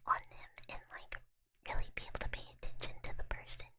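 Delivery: unvoiced-free ASMR whisper throughout; whispered chat. Real, not acted. Streamer chatting to viewers about streams and bedtime, whispering. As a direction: chat away in a whisper, no full voice.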